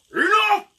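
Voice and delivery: huge, high, booming shout; thunderous bellow. Acted. Magician on the roof halting the brawl, thunderous bellow. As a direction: bellow it like a cannon going off. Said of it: "like this bellowing voice, like thunder".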